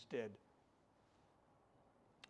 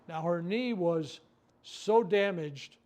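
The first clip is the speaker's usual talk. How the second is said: slow, louder, stretching "so damaged"; drawn-out emphasis; 3 semitones higher, 8 dB louder, much slower than usual. Real, not acted. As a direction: lean hard on "so damaged", very slowly. Stressing how bad the knee was.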